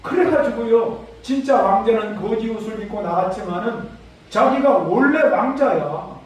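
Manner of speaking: normal talking voice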